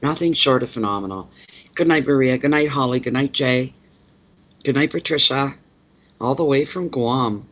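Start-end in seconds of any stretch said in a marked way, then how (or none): none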